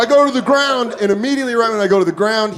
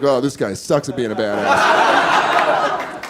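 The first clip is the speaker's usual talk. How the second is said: unimpressed robber; 9 semitones lower, slower than usual. Retold or imitated. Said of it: "This guy was like".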